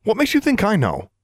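high, sharp snap of irritation; a touch too sharp, defensive. Acted. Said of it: "Alexandra snapped, a little too sharply".

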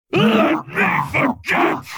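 huge slow roaring beast bellow. Acted. Warwick bellows at Jinx in anguish. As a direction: roar it out in agony. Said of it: "he bellowed".

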